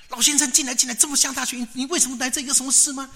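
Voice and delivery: high, loud, hurried host; urgent welcome. Acted. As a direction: usher a visitor in with concern. Host urges old visitor inside.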